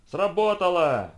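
loud, high, drawn-out cry; exclaiming. A narrator's reading. Photographer as the trap snaps shut, exclaiming. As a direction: exclaim it slowly at full voice. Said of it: "воскликнул Джон Толкер".

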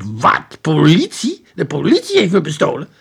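loud, outraged father; shocked, loud exclamation; shouted. A narrator's reading. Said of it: "kwam hijgend kijken en riep".

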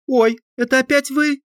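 put-on high surprised girl voice; girl's voice. A narrator's reading. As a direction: chirp it like a young girl.

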